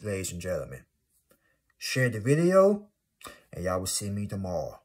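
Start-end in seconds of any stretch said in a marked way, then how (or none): 1.8-2.9 emphatic request. Real, not acted. Emphatic sign-off.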